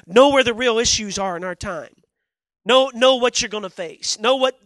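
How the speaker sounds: full-force pulpit preaching; fiery preaching. Acted. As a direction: preach it hard to the crowd.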